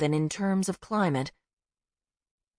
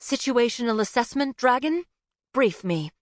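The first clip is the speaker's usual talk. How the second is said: commanding snap; 6 semitones higher than usual. A narrator's reading. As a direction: snap out orders like an officer. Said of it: "Infusing her voice with a snap of command".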